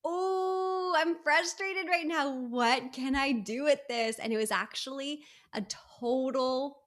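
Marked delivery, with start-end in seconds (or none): 0.0-4.2 high, loud drawn-out "oh", easing down as it goes; hammed-up exasperation, starting high and settling; retold frustration. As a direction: re-enact frustration turning into a question. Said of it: "I was frustrated in a moment".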